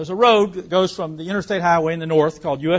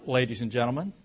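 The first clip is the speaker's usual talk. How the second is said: ordinary delivery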